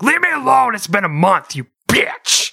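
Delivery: high, loud comic yell; yelling at wife caught at the fridge; mock shout. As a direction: scream it back in mock outrage. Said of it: "I'm like".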